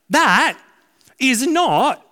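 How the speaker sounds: loud, high, emphatic declaration. Real, not acted. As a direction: hit it hard: that is not. Pastor stresses a point strongly.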